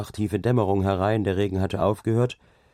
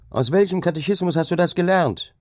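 high, raised, scornful question; indignant disbelief; 11 semitones higher, 4 dB louder than usual. A narrator's reading. Dike reeve scolds the laborer with high indignant disbelief. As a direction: challenge him, outraged.

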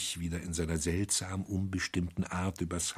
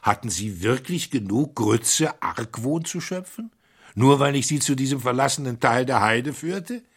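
higher and louder, incredulous; growing curiosity; 6 semitones higher, 5 dB louder than usual. Acted. The robber questions the priest, loud amazed curiosity. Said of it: "mit gesteigerter Neugier".

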